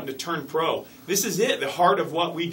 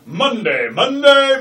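rising booming announcer call, much louder; announcer hype voice; 9 semitones higher, 11 dB louder, much slower than usual. Acted. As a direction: build the monster-truck announcer yell. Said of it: "starting to sound like a monster".

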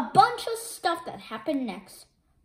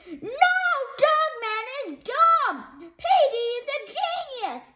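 bragging; 11 semitones higher than usual. Acted. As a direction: crow about your own genius. Petey bragging about himself.

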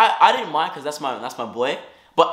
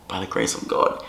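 hushed, awed aside; breathy whispered thanks; 9 semitones lower, 6 dB quieter than usual. Real, not acted. Telling friends the phone was recovered, a whispered thanks. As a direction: whisper it under her breath, grateful.

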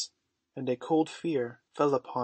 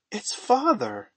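high, loud, drawn-out surprise; astonished exclamation; 9 semitones higher, 7 dB louder, slower than usual. A narrator's reading. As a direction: exclaim in slow, high-pitched amazement. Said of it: "exclaimed Wendy".

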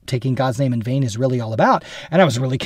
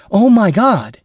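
higher, louder exasperated "Oh my God"; 6 semitones higher, 6 dB louder, slower than usual. Shown as a demonstration. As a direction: toss it off in frustration. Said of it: "in exasperation".

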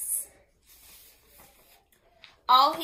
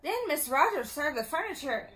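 ordinary delivery

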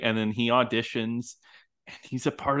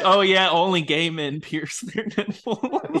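higher, slow drawn-out mockery; mock agreement; 5 semitones higher, 4 dB louder than usual. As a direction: scoff it slowly, breaking into a laugh. Mocking a stereotype from the film.

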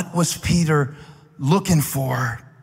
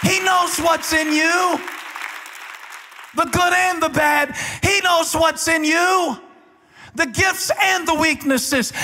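full-force preaching; 9 semitones higher than usual. Acted. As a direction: preach it to the back row, rising and hammering.

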